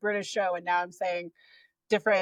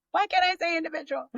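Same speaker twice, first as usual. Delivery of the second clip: high, quick, laughing at yourself; laughing through a tongue-tied moment; amused self-exasperation; 10 semitones higher, faster than usual. Real, not acted. Speaker laughs after tripping over a word, amused exasperation.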